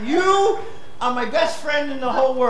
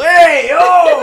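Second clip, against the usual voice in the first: loud, high street holler; Italian "hey yo" shout; 7 semitones higher, 9 dB louder than usual. Retold or imitated. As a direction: bellow it across the block like a neighbor. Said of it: "so it's called".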